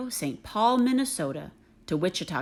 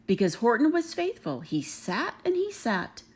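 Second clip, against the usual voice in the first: plain reading voice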